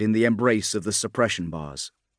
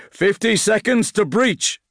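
commander's call; 10 semitones higher, 3 dB louder than usual. Acted. Commander calls out to squad.

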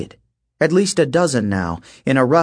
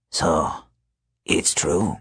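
low, quiet, thoughtful growl; musing wolfman; 6 semitones lower, 4 dB quieter, slower than usual. Acted. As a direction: muse it low like a wolf king. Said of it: "he mused".

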